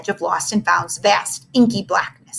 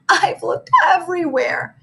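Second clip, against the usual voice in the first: sobbing; 8 semitones higher than usual. Acted. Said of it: "Missy sobbed".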